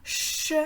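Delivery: "ch" as a voiceless, sustained shush; isolated CH sound. Shown as a demonstration. Teacher revealing the repeated sound, "ch" as a long shush. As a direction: sound out "ch" alone, not as letter names. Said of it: "les lettres qui font le son ch".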